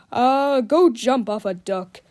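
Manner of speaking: defiant. A narrator's reading. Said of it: "said Captain Underpants defiantly".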